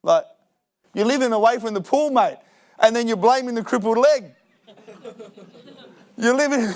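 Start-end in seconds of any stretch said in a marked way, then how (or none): 2.1-6.8 laughing. Real, not acted.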